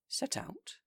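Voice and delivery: high, disbelieving question; shocked echo. Acted. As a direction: squeak it out in disbelief.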